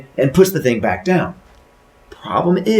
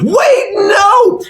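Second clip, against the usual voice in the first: very high, loud stop cry; panicked shout; 7 dB louder than usual. Acted. Husband stopping wife opening box, panicked high shout. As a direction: yell it to stop them at once. Pushes her away from box.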